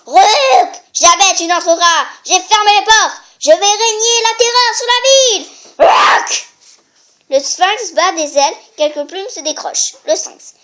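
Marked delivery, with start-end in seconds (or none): 0.1-5.5 loud, high monster threat; menacing sphinx. Acted. The sphinx threatening Oedipus, loud and menacing. 5.8-6.5 sphinx croak. Acted. Sphinx cry.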